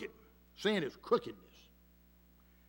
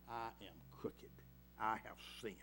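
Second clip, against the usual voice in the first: hushed confession; 8 semitones lower, 19 dB quieter than usual. Acted. Sermon confession, voice drops low.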